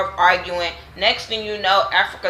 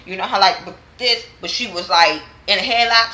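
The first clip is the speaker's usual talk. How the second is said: ordinary talk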